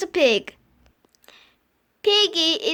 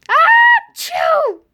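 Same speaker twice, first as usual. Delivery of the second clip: loud shouted high sneeze; huge sneeze; 10 semitones higher, 9 dB louder than usual. Acted. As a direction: blast out a giant sneeze.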